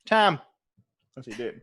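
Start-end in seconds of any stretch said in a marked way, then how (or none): 0.1-0.4 louder, higher Irish "Tam"; "Tam" in an Irish accent with an open a. Retold or imitated. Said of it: "Put an A instead of an O".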